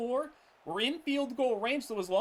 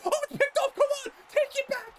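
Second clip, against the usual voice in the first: much higher, louder urgent cheer; excited cheering; 4 dB louder, much faster than usual. Real, not acted. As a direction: urge the defender to run it back. Gamer cheers an interception.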